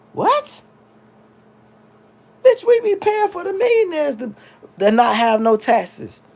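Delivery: high, loud outraged disbelief; incredulous mock quote. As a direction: play the shocked taxpayer, voice jumping up. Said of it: "How in the fuck could we ever say".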